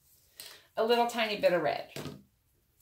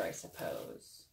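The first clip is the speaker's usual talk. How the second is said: soft whispered afterthought; whispered aside; 16 dB quieter, slower than usual. Real, not acted. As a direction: breathe it under your breath.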